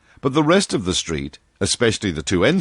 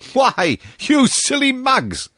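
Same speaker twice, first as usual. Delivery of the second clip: slow, high mocking cry; high, slow mocking call to the friends; slower than usual. A narrator's reading. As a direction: hoot it at them with a laugh in it. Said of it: "he cried".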